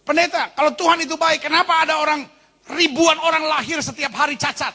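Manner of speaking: imitating an angry challenge, pitched high; mock-indignant question to the pastor; protes marah. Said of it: "Sebagian orang marah". (menirukan orang marah, nada tinggi)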